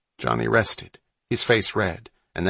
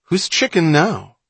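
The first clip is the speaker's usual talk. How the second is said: higher, louder reckless dare; reckless taunt; 8 semitones higher, 5 dB louder than usual. A narrator's reading. Boy goading his brother about the boat, reckless. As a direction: throw it out as a cocky taunt. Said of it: "Then, recklessly, he added".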